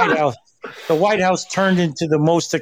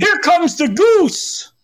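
excited; 11 semitones higher, 4 dB louder, much slower than usual. Pretend. Joking announcement over music.

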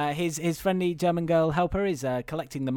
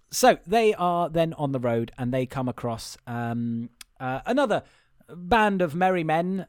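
ordinary delivery

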